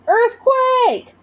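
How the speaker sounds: high urgent alarm shout. A narrator's reading. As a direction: yell the warning, high and sharp. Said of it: "Mom shouted".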